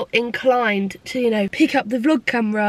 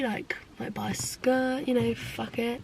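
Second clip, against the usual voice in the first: breathy whisper, much softer; a hushed, conspiratorial whisper to herself; whispered aside; 3 semitones lower, 11 dB quieter than usual. Retold or imitated. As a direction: whisper the thought like a guilty secret. Retold thought while shopping.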